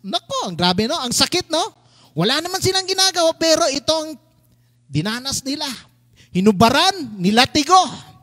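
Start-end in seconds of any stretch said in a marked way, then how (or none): none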